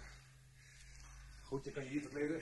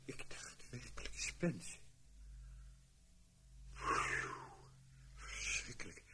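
shaken whisper; 7 dB quieter, slower than usual. Acted. Shaken after the shooting.

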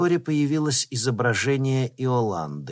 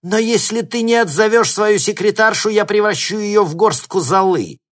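high, loud, furious ultimatum; raging, menacing raised voice; angry threat; 10 semitones higher, 7 dB louder than usual. A narrator's reading. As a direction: shout the threat down at him. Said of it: "зло сказала она".

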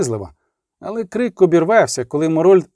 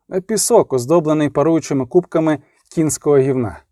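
ordinary delivery, nothing marked

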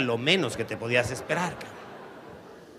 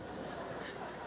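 breathless laugh; 16 dB quieter than usual. Real, not acted. Laugh after outburst.

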